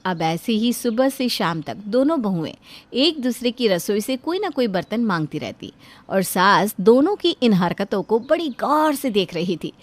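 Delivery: ordinary delivery, nothing marked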